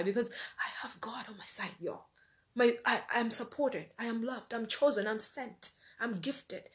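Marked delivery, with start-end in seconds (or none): 0.0-2.1 a sudden hushed whisper; a preacher confiding to viewers, in a whisper; whispered aside. Real, not acted. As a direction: lean in and whisper it. Confiding faith to the audience.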